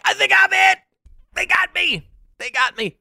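high, hammed-up cry of being hit, easing off; mock wounded cop. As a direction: play a melodramatic casualty for laughs. Acts out cop believing he's hit.

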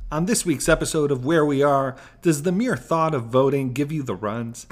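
loud trailer-style promo; announcer voice. Acted. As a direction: sell it like a trailer. Promo read, third take.

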